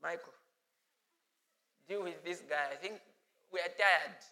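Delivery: a hint of a weary father; mild tired calm voice; weary God. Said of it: "God just said".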